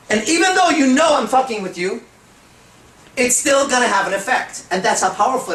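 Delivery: high, loud burst of enthusiasm; excited emphasis. Real, not acted. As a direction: drive it home with energy. Excited aside to audience.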